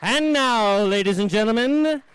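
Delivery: loud show announcer intro; radio host introduces the next segment. Acted.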